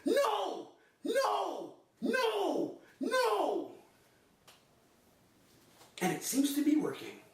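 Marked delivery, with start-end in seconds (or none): 0.0-3.7 high, drawn-out repeated refusals; hammed-up defiant yell, falling off each time; self-defense cry. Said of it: "I learned that in my self-defense class".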